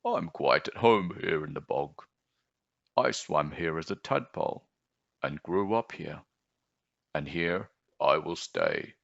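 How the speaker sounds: low frog croak. Acted.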